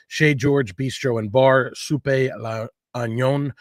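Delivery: slow, careful French accent on the soup name; a hint of a French accent, drawn out; attempted French accent. Shown as a demonstration. Host naming a French soup, in a tentative French accent. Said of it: "I don't know how to say that with a perfect French accent".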